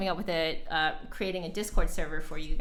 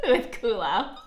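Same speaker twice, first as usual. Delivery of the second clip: laughing; 4 semitones higher than usual. Real, not acted. Laughing while reading the name.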